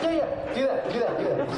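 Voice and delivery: high, eager director urging it on; excited approval. Retold or imitated. Director approving the actor's goblin noise, excited. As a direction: pitch it up, delighted at the find.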